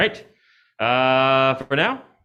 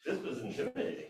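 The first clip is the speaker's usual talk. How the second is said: laughing; 6 dB louder than usual. Real, not acted. Nervous laughing wrap-up.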